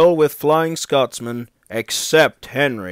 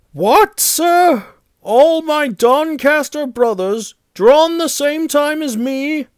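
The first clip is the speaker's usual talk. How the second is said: very high, loud cry of shock; stunned disbelief at terrible news; aghast; 6 dB louder than usual. A narrator's reading. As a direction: wail it in dismay, pitch held high. Engine learns his brothers are scrapped.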